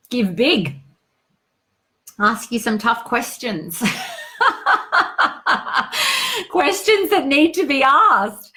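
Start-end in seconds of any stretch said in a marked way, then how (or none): 3.9-6.4 high bursting laugh. Real, not acted. Laughs at own line. 6.5-8.5 slow, loud, higher through laughter; laughing, leaning on each word. Real, not acted. Laughing emphatic.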